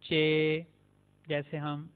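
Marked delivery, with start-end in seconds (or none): 0.0-0.7 "चे" with a ch sound as in chain; Turkish Ç. Shown as a demonstration. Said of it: "चे, ये भी एक मुख्तलिफ लेटर है". ("चे" चेन वाली आवाज़ में)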